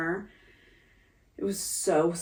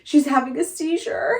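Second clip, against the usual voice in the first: higher, louder alarmed report; 8 semitones higher, 7 dB louder than usual. Retold or imitated. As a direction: relay his panic in a raised voice. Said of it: "And then he goes".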